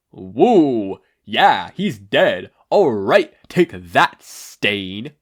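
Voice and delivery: loud triumphant cheering, hammed up; victory whoop. A narrator's reading. Ida celebrating the villain's death, loud gleeful whoops. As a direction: whoop it up after the kill.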